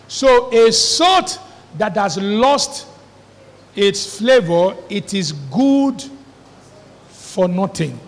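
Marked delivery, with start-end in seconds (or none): none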